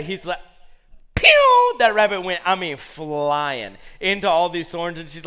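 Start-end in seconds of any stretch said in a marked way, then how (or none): 1.1-1.8 very high, loud "pew"; sudden shooting-away sound effect; zooming-off noise. Retold or imitated. Rabbit bolting from the lap, imitated zoom.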